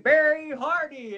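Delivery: high, loud, drawn-out ring announcement; ring announcer call. Retold or imitated. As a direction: boom out the name like an announcer. Wrestler's name called on TV.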